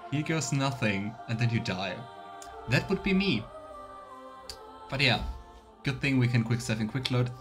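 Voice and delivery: ordinary talk, nothing marked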